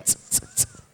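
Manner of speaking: three short unvoiced hi-hat tss sounds; quick clipped tss tss tss; very short beatbox hi-hats. Shown as a demonstration. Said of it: "very short".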